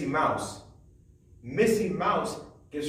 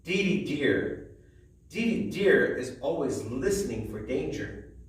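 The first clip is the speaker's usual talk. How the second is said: usual speaking voice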